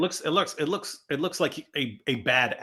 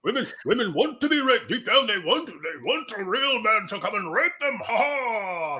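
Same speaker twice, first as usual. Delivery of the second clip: higher-pitched, hammed-up preacher parody; theatrical mock impression; 7 semitones higher than usual. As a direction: imitate an overdone orator with stagy laughs. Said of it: "That's how he talks".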